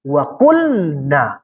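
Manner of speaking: melantunkan ayat dengan sangat pelan; bacaan ayat pelan. Shown as a demonstration. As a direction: lafalkan Wa qulna perlahan seperti tilawah. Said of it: "Tadi apa katanya?". (ayat Al-Qur'an dibaca pelan dan khidmat)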